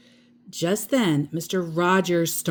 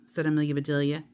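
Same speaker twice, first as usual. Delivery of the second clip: plain reading voice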